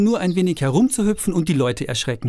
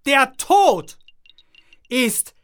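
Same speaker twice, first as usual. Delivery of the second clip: booming announcement; 10 semitones higher, 4 dB louder, much slower than usual. Acted. Jester announces the death dance.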